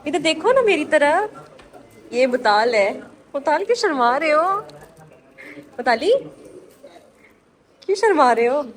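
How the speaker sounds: high, loud sing-song coaxing. Real, not acted. Aunt to shy child at the camera, playful coaxing. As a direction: coax a shy little one to look up.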